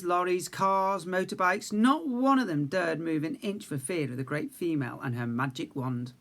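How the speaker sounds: usual speaking voice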